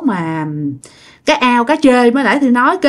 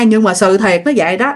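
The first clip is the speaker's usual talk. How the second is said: plain reading voice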